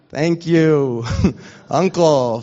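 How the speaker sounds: thanks through real laughter; laughing thanks. Real, not acted. Pastor thanking his uncle for the joke, laughing. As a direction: laugh while thanking him.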